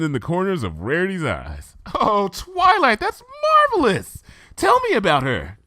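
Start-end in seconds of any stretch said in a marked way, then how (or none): 1.9-5.6 Rarity, delighted. Acted. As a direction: gush like a thrilled fashionista. Rarity voiced by reader.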